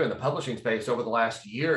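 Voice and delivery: ordinary talk, nothing marked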